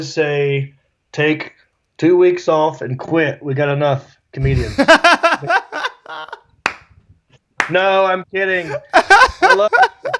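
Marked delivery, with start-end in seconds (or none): 4.8-6.3 a high, loud burst of real laughter. Real, not acted. Laughs at own joke. 8.9-10.2 laughed through, high and slow; laughing. Real, not acted. Laughing after joke.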